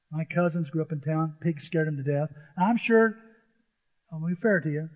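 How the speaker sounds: usual speaking voice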